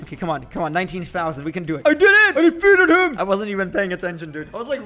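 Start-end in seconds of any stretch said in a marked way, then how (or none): 1.8-3.2 high, loud burst of victory joy; real excitement. Real, not acted. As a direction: celebrate the win at full volume. Boss beaten in game.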